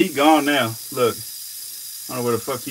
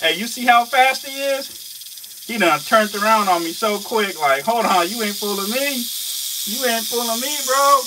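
much higher, louder, slower amazed talk; excited hype; 12 semitones higher, 5 dB louder than usual. Real, not acted. Vlogger tells viewers the snake can't fool him, excited. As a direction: keep riding the thrill of the near miss. Keeps hyping snake's speed.

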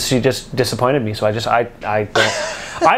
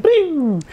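high "bling" sound effect, drawn out; falling cartoon-style zing; imitated zinging whoosh; 12 semitones higher than usual. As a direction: make a playful vocal zoom noise. Imitating a noise mid-story.

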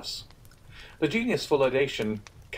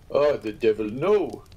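cried; 5 dB louder, slower than usual. Acted. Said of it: "cried Albert".

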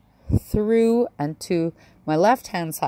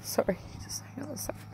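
real whisper, fast, holding back tears; tearful whisper; 6 semitones higher, 10 dB quieter, faster than usual. Real, not acted. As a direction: whisper through tears, trying to recover. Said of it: "trying to pull myself together".

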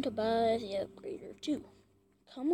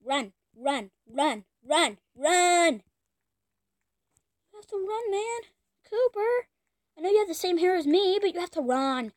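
urgent urging; 5 semitones higher, 5 dB louder than usual. Real, not acted. Urging game character.

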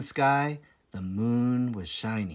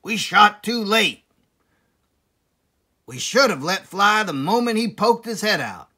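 loud, higher farmer voice, annoyed; angry Boggis; 8 semitones higher, 8 dB louder than usual. Acted. Boggis grumbling to the others, loud and cross. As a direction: complain loudly like a cross farmer. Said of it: "said Boggis".

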